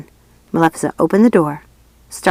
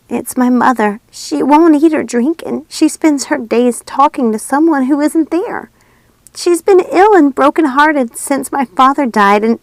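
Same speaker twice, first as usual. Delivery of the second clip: put-on raised, small girl voice, upset; higher, louder girl voice near tears; young girl's voice; 8 semitones higher, 4 dB louder than usual. A narrator's reading. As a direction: speak as a troubled young girl.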